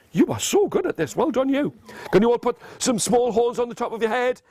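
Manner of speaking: usual speaking voice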